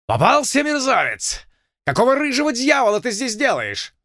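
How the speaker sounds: high, furious yell; angry shout. Acted. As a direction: bark it out in rage. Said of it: "гневный окрик". (гневный окрик)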